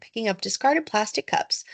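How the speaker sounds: usual speaking voice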